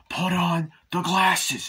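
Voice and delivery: loud, high, very slow acted order; forceful command. Hero orders friend in parking lot, forceful drawn-out imitation. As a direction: stretch each word out as an imitated demand.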